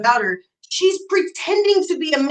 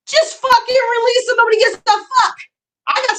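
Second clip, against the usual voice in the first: much higher, loud exasperated yell; angry shout; 12 semitones higher, 4 dB louder than usual. Real, not acted. Streamer yelling at rival to release messages, angry shout. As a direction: blast her for stalling.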